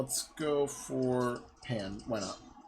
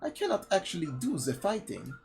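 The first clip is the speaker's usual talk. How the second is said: high, hammed-up French accent; ridiculous French accent; 6 semitones higher than usual. Defenseless woman refusing to fight, ridiculous high French accent. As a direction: play helpless in a silly French accent. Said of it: "pretending to have the ridiculous accent".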